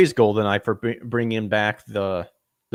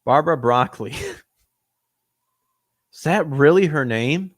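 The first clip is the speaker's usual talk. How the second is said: ordinary talk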